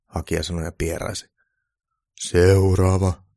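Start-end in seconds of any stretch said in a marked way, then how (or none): 2.2-3.2 long, loud drawn-out summons; shouted call. A narrator's reading. Santa summoning the next applicant, slow shouted call. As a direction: call out slowly to the waiting room. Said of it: "joulupukki huusi".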